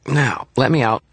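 high little fairy making a demand; high, childlike insistence; Toot's high-pitched voice. A narrator's reading. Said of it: "His voice was high-pitched".

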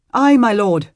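put-on loud, high young squire; earnest vow. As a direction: swear it firmly. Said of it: "his squire vowed".

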